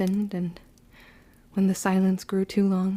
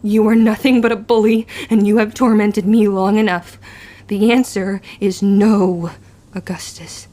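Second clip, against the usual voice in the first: defiant fury; 10 dB louder than usual. Acted. Tilla refuses Augustus at last, loud and defiant. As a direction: spit it back at the bully.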